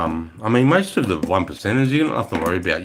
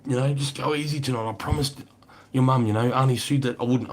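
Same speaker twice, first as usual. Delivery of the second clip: higher, coaxing imitation of the cousin; cousin pleading; 6 semitones higher than usual. Cousin begging him to go easy tonight, higher coaxing imitation. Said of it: "I hear my cuz come out".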